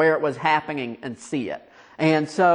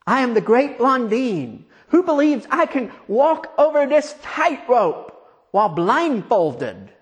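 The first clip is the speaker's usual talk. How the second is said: raised, ringing showman's boast; showman whipping up crowd; 8 semitones higher, 4 dB louder than usual. Acted. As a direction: work the crowd up, pitched high. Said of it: "And then he says".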